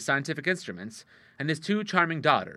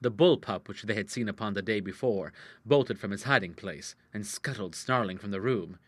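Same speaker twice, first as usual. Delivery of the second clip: ordinary delivery, nothing marked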